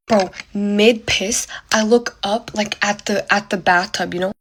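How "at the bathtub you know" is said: ordinary delivery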